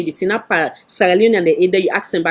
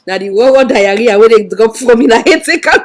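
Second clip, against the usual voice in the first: mock laughing reply; 5 dB louder than usual. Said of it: "Then I say".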